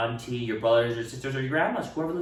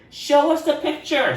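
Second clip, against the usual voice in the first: put-on high, louder kid; eager request; 14 semitones higher, 5 dB louder than usual. Classmate asking Ralph for the picture, high and eager. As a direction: ask eagerly.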